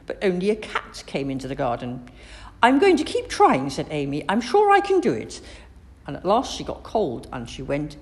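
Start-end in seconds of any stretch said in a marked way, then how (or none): 2.6-3.7 put-on high, loud, plucky girl; spirited child, pitched right up; determined resolve. A narrator's reading. Girl resolves not to give up. 4.3-5.4 put-on very high, loud, plucky child; bright-eyed girlish self-belief, pitched up; determined confidence. A narrator's reading. Girl encourages herself.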